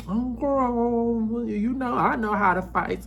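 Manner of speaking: high, airy mock-sweet voice; a sugary, taunting impression of Kay. Kay answering Stacey with a sweetly taunting high voice. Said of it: "And Kay's like".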